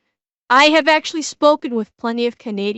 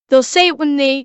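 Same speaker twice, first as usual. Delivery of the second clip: usual speaking voice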